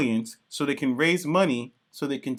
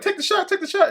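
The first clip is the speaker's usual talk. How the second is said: much higher, eager mock Dick urging. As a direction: squeak it out as an excited sidekick. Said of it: "Dick is all like".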